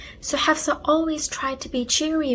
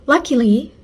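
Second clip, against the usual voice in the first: plain reading voice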